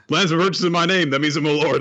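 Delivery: high, loud mocking take-off; mocking quote. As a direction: parrot him in a pompous voice.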